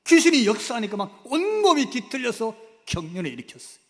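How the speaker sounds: loud, driving preacher's delivery; forceful preaching. Acted. Preacher describing the seizure to the congregation, loud. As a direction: proclaim it with full force.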